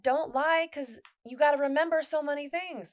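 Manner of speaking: high, loud quoted voice; quoting a saying. Retold or imitated. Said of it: "Tom Sawyer or something said".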